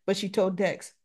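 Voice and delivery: ordinary delivery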